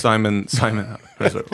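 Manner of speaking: ordinary delivery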